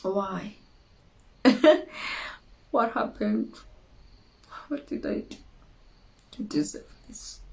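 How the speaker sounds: hushed, breathy disbelief, trailing off; quiet shocked why after a failure; stunned dismay. Pretend. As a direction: ask it under your breath as if just let down.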